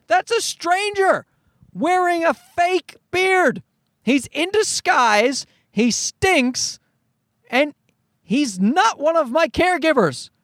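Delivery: high, drawn-out outraged panic; mock-horrified protest, stretched words; terrified child. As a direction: plead in a high voice as an alarmed kid. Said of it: "children are absolutely right to be terrified".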